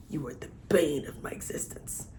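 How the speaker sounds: breathy whisper of a lover's confession; hushed imitation of a lovesick hero. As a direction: whisper it like a secret. Quoting his love confession.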